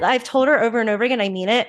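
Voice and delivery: normal talking voice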